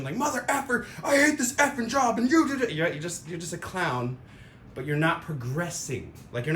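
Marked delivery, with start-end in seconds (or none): 0.2-2.7 much higher, angrier mock cursing outburst; angry rant. Mocking someone venting in anger, high-pitched cursing rant. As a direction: blow up like a frustrated worker. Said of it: "like, emotion".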